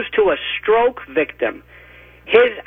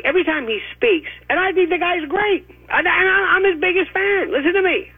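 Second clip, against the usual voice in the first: high emphatic protest; 11 semitones higher than usual. Real, not acted. Insisting he's Trump's fan.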